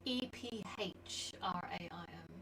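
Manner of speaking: slow, letter by letter whisper; slow spelling. Real, not acted. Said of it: "How do you spell Ephraim".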